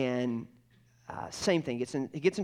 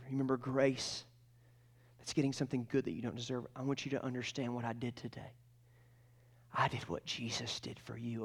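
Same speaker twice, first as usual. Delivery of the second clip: soft, solemn retelling of a father's words; tender fatherly explanation; 7 dB quieter than usual. Retold or imitated. Father explaining to his son the punishment he took, tender.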